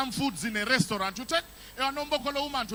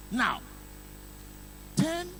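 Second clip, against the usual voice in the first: usual speaking voice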